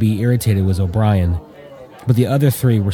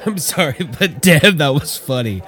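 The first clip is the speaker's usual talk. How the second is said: still laughing; 6 semitones higher than usual. Acted. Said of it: "all broke out in laughter".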